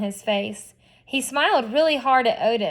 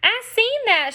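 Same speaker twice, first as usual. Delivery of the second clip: put-on little girl voice; 10 semitones higher than usual.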